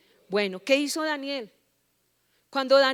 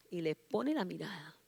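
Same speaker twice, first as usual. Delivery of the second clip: hushed, whispered narration; stealthy whisper; 5 semitones lower, 12 dB quieter than usual. Real, not acted. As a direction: whisper it like a secret watcher. Devil stealthily sets eyes on believer.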